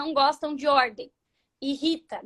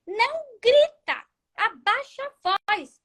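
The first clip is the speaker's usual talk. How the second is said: ordem ríspida, mais aguda e lenta; mandona; 8 semitones higher, slower than usual. Retold or imitated. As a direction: dar bronca imitando quem manda. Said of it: "não adianta você falar assim". (ordem mandona e aguda, bem devagar)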